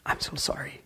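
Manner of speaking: soft, whispered apology. Retold or imitated. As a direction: breathe an ashamed sorry. Wife apologizes for the assault.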